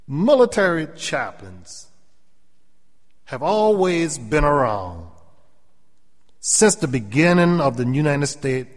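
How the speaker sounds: high, loud, slow preaching. Real, not acted. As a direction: lift it like a preacher in full flow. Preaching builds.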